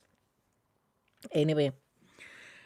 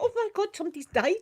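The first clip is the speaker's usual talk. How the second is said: high, fast panicked gasp; sudden alarm, rushed and high; 11 semitones higher, faster than usual. Retold or imitated. Seeing the mute lady gesture, panicked high cry. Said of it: "And I was like".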